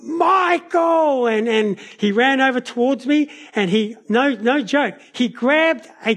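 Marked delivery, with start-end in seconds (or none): 0.0-1.3 retold angry yell, pitched high; yelled name. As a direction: bellow the boy's name across the yard. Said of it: "he yelled out".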